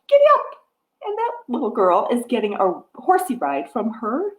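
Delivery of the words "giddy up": high, loud giddy up; excited giddy up. Pretend. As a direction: cheer the pony on. Rider calls to pony.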